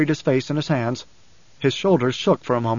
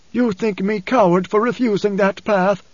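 higher, husky, trembling father's voice; wounded, husky quaver; 6 semitones higher than usual. A narrator's reading. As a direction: tremble with hurt pride, voice pitched up. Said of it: "his voice husky and trembling".

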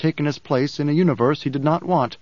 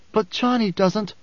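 urgent plea; 7 semitones higher than usual. A narrator's reading. Alia pleading.

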